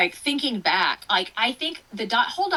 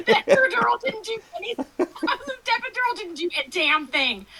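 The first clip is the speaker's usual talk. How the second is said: laughing hard, higher, through the line; 11 semitones higher than usual. Real, not acted. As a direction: giggle through the complaint. Laughing about failed epidural.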